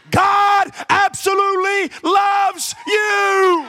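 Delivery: God absolutely loves you drawn out and shouted, God hit hardest; high, loud, slow shout. Shown as a demonstration. Pastor repeating it so the congregation gets it, slow loud shout. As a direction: belt it out slowly, every word held. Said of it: "Let me say it again".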